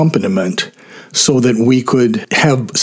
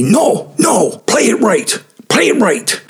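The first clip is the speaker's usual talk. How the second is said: high-pitched imitation of an irate conductor; pissed-off conductor; 10 semitones higher than usual. Conductor stops the chorus to correct the pianist, pissed off. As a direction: snap it much higher, in a put-on accent. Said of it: "conductor was always pissed off".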